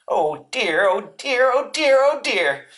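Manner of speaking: high-pitched worried little creature voice; rising, anxious high fretting; fretting Piglet. A narrator's reading. Piglet frets to himself near the trap, high and worried. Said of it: "said Piglet to himself".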